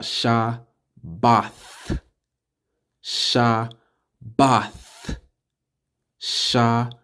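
"Shabbath" in two separated syllables ending in th; final th. Shown as a demonstration. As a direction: repeat "Shabbath" as sha, pause, bath. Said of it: "With a T H at the end".

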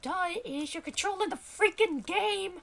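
high-pitched, scornful character; indignant high-voiced sidekick; exasperated sidekick voice. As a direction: argue back in a high little voice. Character argues with the player.

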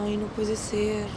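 low-volume, drawn-out dismay. Real, not acted. Host reacting after the couple walks out, dismayed. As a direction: say it softly and slowly, taken aback.

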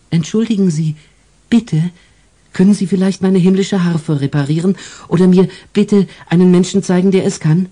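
put-on high childlike angel voice, asking politely; a little angel pleading sweetly; small angel. Angel asks politely again.

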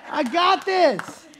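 high, loud, slow triumphant declaration; proud and fired up; triumphant kid. Retold or imitated. Kid holding the chair up, loudly declaring he's got it.

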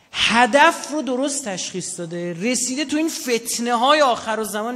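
high, loud and slow, full pulpit force; rousing preaching. Real, not acted. Preacher praising the martyr to the crowd, rousing loud cry.

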